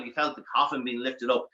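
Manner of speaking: usual speaking voice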